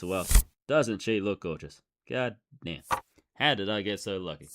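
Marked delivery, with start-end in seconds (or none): none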